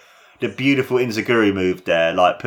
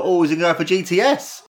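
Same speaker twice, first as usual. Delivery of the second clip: high, fast excited commentary; excited call; 8 semitones higher than usual. Real, not acted. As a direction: shout out the finisher. Excited call of big moves.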